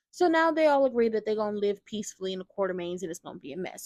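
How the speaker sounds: ordinary delivery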